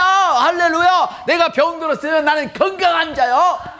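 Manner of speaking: high, drawn-out preacher's cry; fervent preaching. Acted. Pastor preaching to the congregation, fervent. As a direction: preach it with rising fervor.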